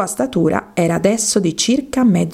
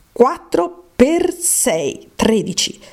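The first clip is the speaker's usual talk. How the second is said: slow, high sing-song times table; slow recitation; 5 semitones higher, much slower than usual. Acted. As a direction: drag out the sum like a schoolgirl reciting. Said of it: "Proviamo un po'". (tabellina recitata lenta e cantilenante)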